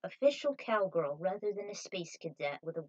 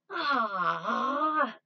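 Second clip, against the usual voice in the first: short startled cry; 4 semitones higher, 8 dB louder than usual. Acted. Sudden cry in scene.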